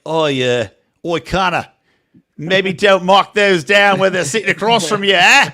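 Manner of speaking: light mock Australian producer accent; a teasing hint of Australian twang; Australian producer gently scolding Connor, in a mild Australian accent. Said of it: "I think the producers are Australian".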